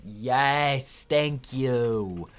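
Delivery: loud, bright gratitude; eager thanks. Acted. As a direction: gush the thanks. Helper character gratefully cheering.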